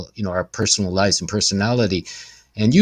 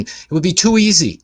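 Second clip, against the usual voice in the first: ordinary talk, nothing marked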